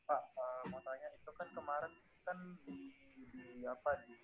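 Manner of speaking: faint and far from the mic; words lost in a soft, distant voice; barely audible. Real, not acted. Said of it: "Suaramu kecil banget".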